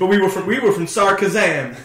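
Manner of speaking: lightly put-on bad American accent; a touch of hammed-up American. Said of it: "these really bad American accents".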